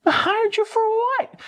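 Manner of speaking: very high, drawn-out squawk of disbelief; incredulous. Retold or imitated. Told he hired his drunk friend, acting out incredulity. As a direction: stretch it out in comic astonishment. Retold disbelief at being employer.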